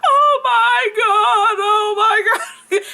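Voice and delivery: slow, high, sing-song sobbing oh my God; crying. Retold or imitated. Starstruck fan meeting [name], slow high tearful wail. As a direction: wail it out high and drawn, half laughing. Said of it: "I'm sitting here crying like".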